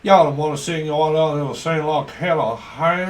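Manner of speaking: loud hammed-up Southern US singer voice; Southern US accent. A Southern US singer refusing to sing, mock Southern US drawl. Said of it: "southern drawl".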